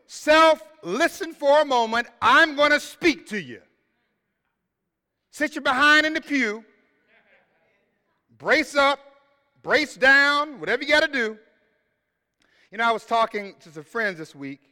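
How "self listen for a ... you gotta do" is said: louder, higher commanding call to the self; higher, loud, bossy self-address; psalmist commanding himself. Acted. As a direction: order your own soul to attention. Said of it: "The psalmist stands up and says".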